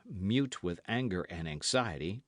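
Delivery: ordinary delivery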